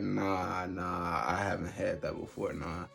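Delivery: tired fading refusal. Retold or imitated. To his date offering tea at 3am, tired fading no. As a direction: let it fade like you're half asleep. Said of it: "I was so tired".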